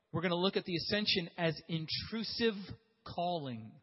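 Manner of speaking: usual speaking voice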